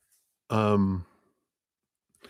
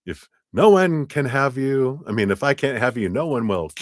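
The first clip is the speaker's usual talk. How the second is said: higher, louder mock menace; hammed-up possessive threat; clingy lover joking obsessively, hammed-up; 8 semitones higher, 4 dB louder than usual. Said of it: "we're like".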